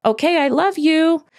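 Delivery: mock-soothing, honeyed and insincere; fake sweet voice. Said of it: "tone in your voice of".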